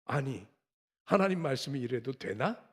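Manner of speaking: ordinary delivery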